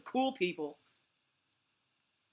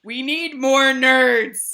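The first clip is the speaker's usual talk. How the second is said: slow, loud "more nerds"; drawn-out declaration; 10 dB louder, much slower than usual. Retold or imitated. To mom about family nerds, slow loud proclamation. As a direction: stretch it out for emphasis.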